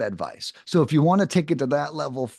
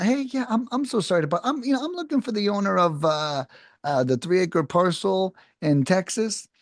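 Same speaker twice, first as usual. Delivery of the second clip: higher, halting, searching for the words; mildly confused; 6 semitones higher than usual. Shown as a demonstration. Sales rep sounding mildly confused to a seller on a cold call. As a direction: stumble as if you lost your place. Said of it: "sound potentially mildly confused".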